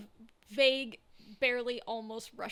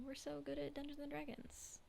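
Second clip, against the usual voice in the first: hushed mock boast, trailing into a whisper; soft, tongue-in-cheek murmur; hushed aside; 4 semitones higher, 12 dB quieter than usual. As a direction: say it small and very soft, dropping to a whisper.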